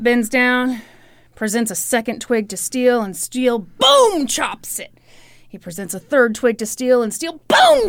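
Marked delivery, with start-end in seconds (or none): 3.6-4.3 high, loud sound-effect boom; booming exclamation, pitched way up; impact boom. Acted. Voices the chopping blow. 7.5-7.9 high, drawn-out booming effect; big exclaimed boom, pitched way up; impact boom. Acted. Voices the next chopping blow.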